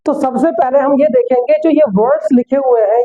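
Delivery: ordinary talk, nothing marked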